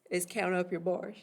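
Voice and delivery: ordinary talk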